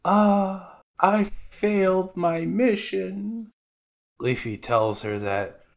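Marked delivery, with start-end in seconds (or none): 0.0-3.5 put-on high, slow, mournful girl's voice; girlish Apis voice. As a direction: whimper it like a defeated young girl. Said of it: "She's like".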